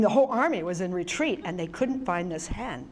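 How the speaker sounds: ordinary talk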